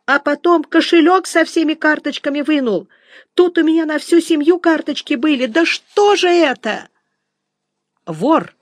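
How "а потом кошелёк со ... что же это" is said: high, wailing woman's outcry; piercing cries. A narrator's reading. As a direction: cry out in shock to everyone around. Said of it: "пронзительно кричала". (пронзительный женский крик)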